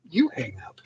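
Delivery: hushed, breathy-free teasing whisper. Real, not acted. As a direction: lean in and whisper it like a dare. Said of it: "teasing whisper".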